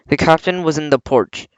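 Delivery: plain reading voice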